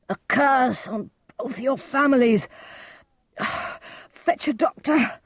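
high, strained, breath-broken cursing; wounded cry. A narrator's reading. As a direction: gasp it out as a wounded man. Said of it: "cried Mercutio".

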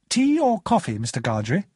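high, courteous host's question; polite. A narrator's reading. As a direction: ask the guest politely, starting high. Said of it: "Herbert asked politely".

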